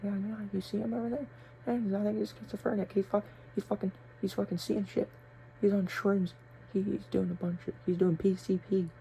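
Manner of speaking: whisper-gossip like a nosy teacher. Retold or imitated. Mocking teachers gossiping about the student, hushed high gossip. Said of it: "teachers over there talking to each other".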